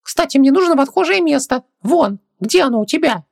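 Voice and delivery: very high little djinn; squeaky creature voice, keen for an outing; Хубаксис, eager. Acted. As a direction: chatter in a tiny high voice.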